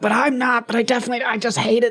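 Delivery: high put-on voice of a man in denial; a falsetto denial, half joking; mock closeted man. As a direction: squeak it out like a guy protesting too much. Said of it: "go like".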